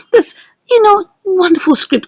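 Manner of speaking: ordinary talk, nothing marked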